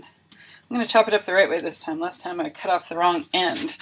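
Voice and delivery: ordinary talk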